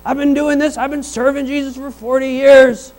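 mock a cranky long-time believer. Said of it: "can be like".

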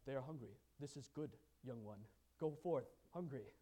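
a low, detached, mock-serene monk; hushed mock-monk, low and detached; aloof monk. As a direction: intone it like a serene monk who doesn't care. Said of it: "The monk would have been like".

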